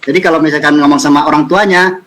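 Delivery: normal talking voice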